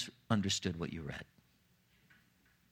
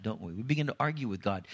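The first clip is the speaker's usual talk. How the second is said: normal talking voice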